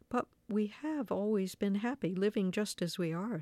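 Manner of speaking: ordinary delivery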